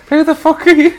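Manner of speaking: high squeaky laughing lad voice; mocking lad. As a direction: blurt it as a cheeky kid. Friend jumps in voicing lad.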